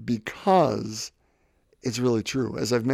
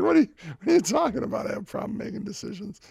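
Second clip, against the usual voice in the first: fast, high, incredulous protest; retold frustration; 9 semitones higher, much faster than usual. Podcaster reliving his indignation at a friend's critique, fast and high. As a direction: rattle it off in offended disbelief. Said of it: "getting really frustrated with him".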